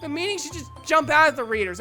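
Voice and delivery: loud, high, exasperated complaint; heated argument. Acted. Natsuki arguing against convoluted poems, heated. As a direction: press the point forcefully. Natsuki argues her case angrily.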